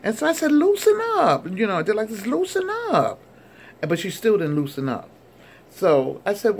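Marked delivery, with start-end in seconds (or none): 0.5-1.4 high, loud acted coaxing; exclaimed plea to relax, pitched way up; playful urging. Urging stiff woman during hug. 2.1-3.2 high-pitched acted coaxing; playful urging. Repeats urging the stiff woman.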